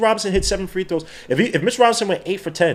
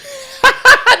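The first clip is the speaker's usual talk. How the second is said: a sudden high, loud burst of laughter; shrieking laugh; 6 dB louder than usual. Real, not acted. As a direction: cackle out loud at the chat message. Howling at a super chat.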